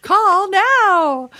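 high, loud infomercial cry; hammed-up shout. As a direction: belt out a mock-urgent command. Playful shouted echo of the plug.

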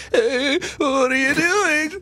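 very high, drawn-out mock voice; hammed-up casual greeting. Brother jokingly imitating himself on the phone, mock high voice. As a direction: squeak it out slowly, jokingly overdoing the casual act. Hammed-up retelling, listener laughs it off.

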